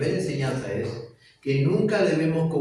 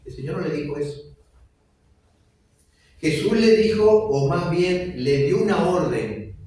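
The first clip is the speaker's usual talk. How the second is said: fervent preaching; 2 semitones higher, 5 dB louder than usual. Acted. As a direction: drive each phrase home to the congregation. Preaching at full force. (predicación fervorosa y lenta)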